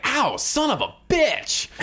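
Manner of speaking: high, slow yelp of pain; ow, that stings, dragged out high. Acted. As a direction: yelp as the holy water burns. Holy water burns his finger.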